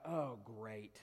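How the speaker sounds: low, soft sinking aside; quiet, deflated groan of words; dismayed thought. Retold or imitated. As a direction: think it aloud with dread. His retold dismayed inner thought.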